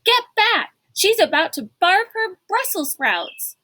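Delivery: frantic shout to the executive; shouted warning. A narrator's reading. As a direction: yell it to clear the way. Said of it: "Kate cried".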